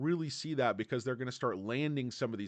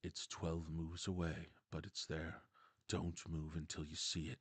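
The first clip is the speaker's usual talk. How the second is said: low, much softer steady murmur; hushed coach; 9 semitones lower, 13 dB quieter than usual. Retold or imitated. Coach explaining the distant checkmate, hushed. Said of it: "He's like".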